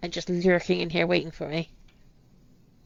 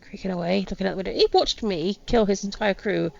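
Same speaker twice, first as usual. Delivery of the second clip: ordinary delivery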